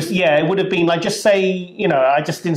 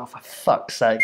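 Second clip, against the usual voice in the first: muttered annoyance; 6 semitones lower, 5 dB quieter than usual. Retold or imitated. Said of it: "like that".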